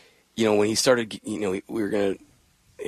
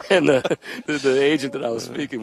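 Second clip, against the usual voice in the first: laughing; 7 semitones higher, 5 dB louder than usual. Real, not acted. Still laughing telling story.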